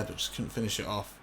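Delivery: ordinary talk, nothing marked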